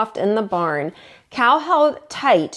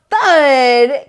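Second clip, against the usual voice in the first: loud punchy sound word; loud thud; 3 semitones higher, 8 dB louder than usual. A narrator's reading. Narrator hits the crash sound, loud. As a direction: land the thud hard.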